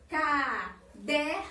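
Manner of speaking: "caderno" drawn out very slowly in separate syllables. Shown as a demonstration. Teacher forming a word from syllables, "caderno" said syllable by syllable. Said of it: "tirar as sílabas intrusa e depois formar uma palavra".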